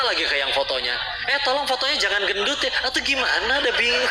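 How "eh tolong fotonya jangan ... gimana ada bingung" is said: mock fussy plea. Quoting a fussy photo subject.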